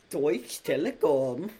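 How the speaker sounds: slow, hammed-up German accent on "Deutsche Telekom"; bad German accent.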